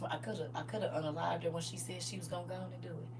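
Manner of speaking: low, quiet, simmering threat; a fed-up Father warns his Son in a low voice; angry quiet God. Acted. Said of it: "He like".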